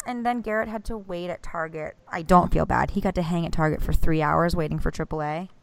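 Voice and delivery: ordinary talk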